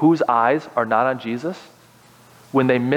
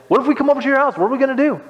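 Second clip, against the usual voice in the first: high-pitched mock protest; put-on high complaint; protesting friends; 10 semitones higher, much faster than usual. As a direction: imitate dismayed buddies in a high voice. Acts out his friends' protest.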